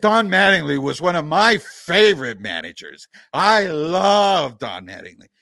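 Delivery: gushing praise laid on thick, loud on key words; sarcastic. Real, not acted. Host mock-praising a fired manager to fans, sarcastic. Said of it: "I'm being sarcastic".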